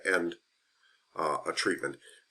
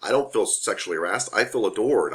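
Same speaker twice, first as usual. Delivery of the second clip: ordinary delivery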